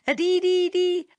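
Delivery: high, chirpy bird call with a laugh in it; laughing chickadee call. A narrator's reading. Tommy Tit laughs his chickadee call at Chatterer. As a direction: chirp it out like a merry little bird. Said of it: "laughed Tommy Tit".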